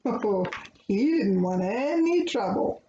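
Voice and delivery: plain reading voice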